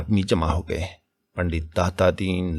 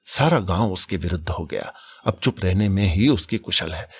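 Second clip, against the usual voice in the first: plain reading voice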